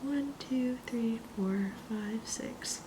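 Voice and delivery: whispered counting; counting under breath. Real, not acted. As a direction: count softly to yourself. Recounting rows quietly to herself.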